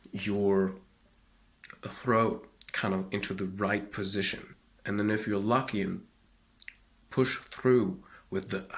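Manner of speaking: usual speaking voice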